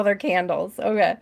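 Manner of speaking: normal talking voice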